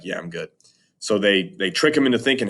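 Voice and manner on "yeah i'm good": meek reply. Retold or imitated. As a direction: lower and much softer, mumbled. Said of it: "And he's like".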